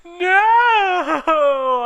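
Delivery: very high, very loud long cry; Ebony screaming at her vision. Acted. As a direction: scream out in anguish. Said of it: "I screamed sexily".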